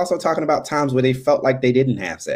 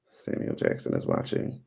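whisper; 12 dB quieter than usual. Real, not acted. Reading out who joined the stream.

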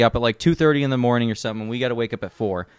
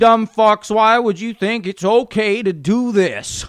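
hammed-up scolding yell; mock drill sergeant chewing-out; 10 semitones higher, slower than usual. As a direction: berate the recruits, pitched way up. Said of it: "he's like".

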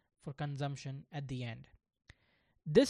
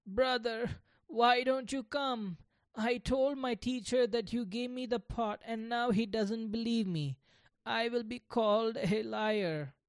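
crying; 7 semitones higher than usual. Acted. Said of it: "he started crying".

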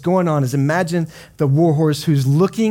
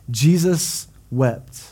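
slow solemn reading; much slower than usual. Acted. Reading shortest verse solemnly.

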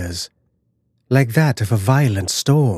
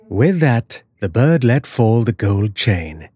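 ordinary delivery, nothing marked

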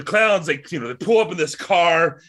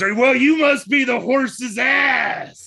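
slow, loud, much higher mocking shout in a put-on clown voice; clown punchline shout; 9 semitones higher, 5 dB louder, much slower than usual. Clown humiliates Joe before the circus, slow loud higher shout. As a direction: draw it out and bellow it to the crowd.

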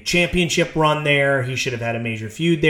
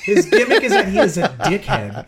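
laughing; 3 semitones higher than usual. Real, not acted. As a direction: say it through a laugh. Laughing about wrestler gimmick.

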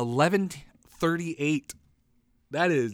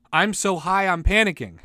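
loud punchline; 5 semitones higher, 5 dB louder than usual. Pretend.

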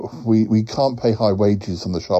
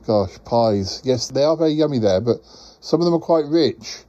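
usual speaking voice

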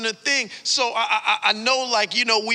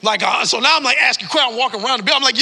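ordinary talk

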